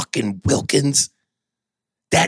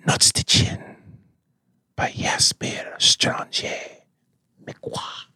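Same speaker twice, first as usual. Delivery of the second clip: breathy whispered fragrance commercial; whispered perfume ad; 3 dB quieter than usual. Acted. Character Jaspier whispers fragrance ad.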